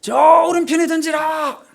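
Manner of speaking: high, loud, drawn-out holler; slow far-carrying shout; shouted command. Shown as a demonstration. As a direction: yell the order across a long stretch of water. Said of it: "그런 상황 아닙니다".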